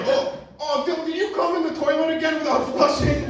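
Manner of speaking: put-on high, scolding girlfriend voice; hammed-up girlfriend asking about the toilet; mock girlfriend. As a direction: nag like a concerned girlfriend, hammed up.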